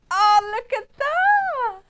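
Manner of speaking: high, loud, slow squeal; overwhelmed by cuteness. Real, not acted. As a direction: gasp of delight at a favourite. Favourite card reveal.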